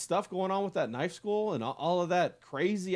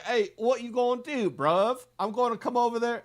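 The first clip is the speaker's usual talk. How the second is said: higher-pitched, put-on English tough; English accent; 8 semitones higher than usual. Showing friends his English accent, mock-threatening English voice. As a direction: attempt a London 'bruv' English accent. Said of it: "can you do an English accent?".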